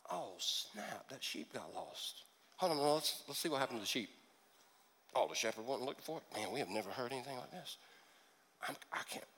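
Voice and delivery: very soft, low aside; hushed amazement. Acted. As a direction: murmur it low, absorbed in a gripping story. Guards whisper while listening to Jesus.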